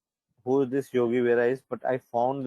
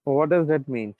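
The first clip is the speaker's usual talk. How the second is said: ordinary talk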